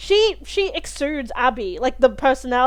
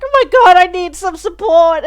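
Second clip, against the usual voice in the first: relieved cry; 5 semitones higher, 7 dB louder than usual. Retold or imitated. Relief as Tommy arrives.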